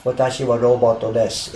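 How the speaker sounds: level robot voice without any emphasis; robot monotone. Shown as a demonstration. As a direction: say it evenly, like a robot. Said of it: "exactly like a robot without any emphasis".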